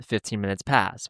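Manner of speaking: ordinary talk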